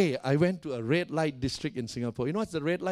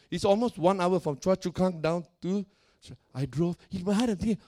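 normal talking voice

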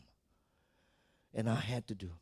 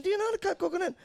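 mock challenge; much faster than usual.

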